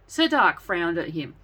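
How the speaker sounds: ordinary delivery, nothing marked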